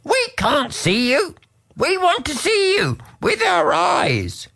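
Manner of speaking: frogs pleading, pitched high. A narrator's reading. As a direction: beg to see it, high and small. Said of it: "the frogs said".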